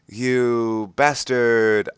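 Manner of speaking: slow, dragged-out hammed-up insult; mock angry shout. A narrator's reading. Ebony yelling at Draco over the tattoo, mild mock anger. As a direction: draw out the insult, lightly voiced anger. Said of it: "I shouted angrily".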